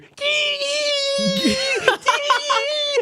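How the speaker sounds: long high shrill toot; nose flute impression. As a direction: imitate a kid blowing a flute. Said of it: "I like his impressions".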